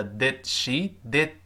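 "did" cut off on a silent d; unreleased d. Shown as a demonstration. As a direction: say "did she" with the d of "did" held, not released. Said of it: "后面那个的听不到".

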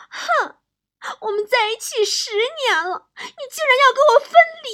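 high-pitched, wounded wifely outburst; angry. Acted. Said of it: "王嫂当时就生气了". (夸张地尖声哭诉，越说越气)

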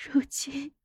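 high, weak, gasping sobs; tearful dying voice. Acted. Dying consort avenged for the sister, high breathless tearful voice. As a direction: break the words up with failing breath.

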